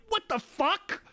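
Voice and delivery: very high squeaky what the fuck; appalled. Retold or imitated. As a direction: shriek it in disbelief.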